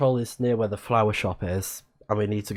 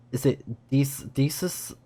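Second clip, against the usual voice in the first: ordinary delivery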